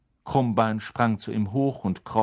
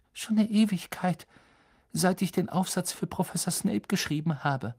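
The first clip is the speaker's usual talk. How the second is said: put-on higher girl's voice, matter-of-fact; a hint of a schoolgirl answering; lightly voiced clever girl; 8 semitones higher than usual. Hermine voiced by narrator.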